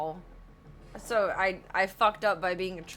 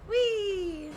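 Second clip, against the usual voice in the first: much higher squeal of glee; gleeful whee; 13 semitones higher, 4 dB louder than usual. Real, not acted. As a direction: let it fly up with delight. Game character launches upward.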